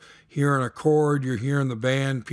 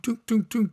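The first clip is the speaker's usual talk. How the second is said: sung bass notes, pitched higher; bass dunks; 8 semitones higher than usual. Shown as a demonstration. Teacher voices the steady bass line as higher dunks. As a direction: hum the bass line, dunk by dunk. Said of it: "that steady dunk".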